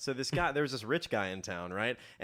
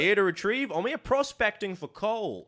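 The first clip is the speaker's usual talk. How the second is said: put-on English accent; 9 semitones higher, 4 dB louder than usual. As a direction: declare your errand in mock English. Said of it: "put on an English accent".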